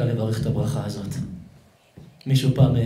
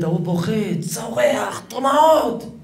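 climbing higher to a mock cry; dramatized wailing; 8 semitones higher, much slower than usual. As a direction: build to a high, acted-out scream. Preacher dramatizes man crying and screaming.

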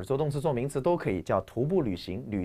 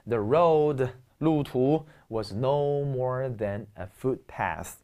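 slow example reading; much slower than usual. Shown as a demonstration. Teacher reads English example slowly.

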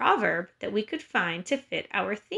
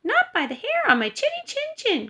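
high-pitched little pig voice; crying out as little pig. A narrator's reading. First pig refuses the wolf at her door, high piggy cry. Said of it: "cried the first little pig".

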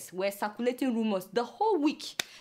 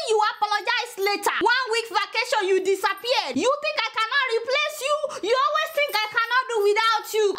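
mock scolding; 7 semitones higher than usual. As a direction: scold him like a cross auntie. Scolding the absent boy.

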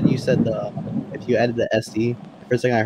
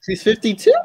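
high-pitched incredulous question; astonished, pitch shooting up; disbelieving; 10 semitones higher, 3 dB louder than usual. Real, not acted. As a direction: ask it in high, shocked disbelief. Disbelief at character's stated age.

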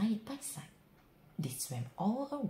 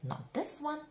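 defiant; 8 semitones higher, 4 dB louder than usual. A narrator's reading. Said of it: "croaked defiantly".

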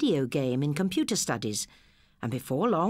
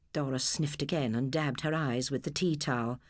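ordinary delivery

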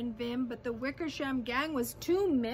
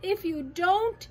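high, urgent rallying call; 6 semitones higher, 5 dB louder than usual. A narrator's reading. Elephant urging the mayor to prove they exist, urgent calling. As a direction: call out insistently, pressing every point. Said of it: "Horton called".

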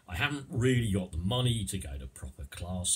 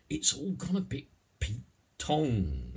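higher-pitched Cockney catchphrase; Cockney accent; 7 semitones higher than usual. Shown as a demonstration. Teacher demonstrating newer slang, in Cockney. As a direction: toss it out as a Cockney. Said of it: "I'll do the first bit in Cockney".

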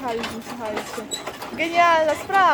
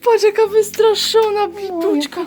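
high, loud doting pet talk; 4 semitones higher, 4 dB louder, slower than usual. Real, not acted. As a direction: coo over a beloved dog. Owner greets her pet after trip.

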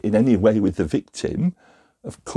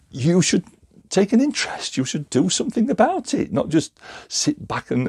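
raised, insistent protest to God; vehement plea; 4 semitones higher than usual. Acted. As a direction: argue with God, pushing hard. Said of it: "we could get very vehement and very emotional".

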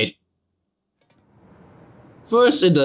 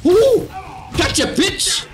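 gloating shout; 13 semitones higher, slower than usual. Real, not acted. Taunting the villain in fight.

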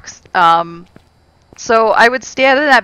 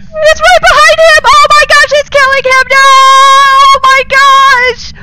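very high, loud horrified shouts; panicked screaming; 14 semitones higher, 11 dB louder than usual. Real, not acted. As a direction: scream as your friend is killed. Ghost kills teammate.

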